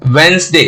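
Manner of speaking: वेंसडे as "wens-day"; two syllables in वेंसडे, no first D; silent D. Shown as a demonstration. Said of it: "क्या हो जाएगा". (वेंसडे में पहला ड न बोलें)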